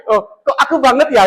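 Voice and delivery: pleased bright exclaim; flattered delight. Retold or imitated.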